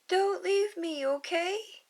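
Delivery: put-on little girl begging him not to leave; little girl calling. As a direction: call out to him, afraid. Lilu calls to Stitch.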